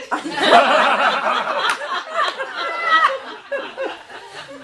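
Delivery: loud high burst of giggling; reader's own giggle bursting out. Real, not acted. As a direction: break into a high helpless giggle. Reader laughs during scene.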